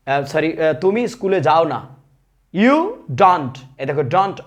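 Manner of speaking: usual speaking voice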